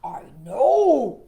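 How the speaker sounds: a frog bursting in eagerly; eager Froggy. Acted. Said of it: "Froggy cried".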